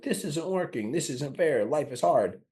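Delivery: high, mock whining; whiny complaint. Mocking a stuck person's complaints, high whining. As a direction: moan like nothing is fair.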